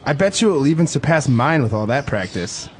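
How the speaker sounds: ordinary delivery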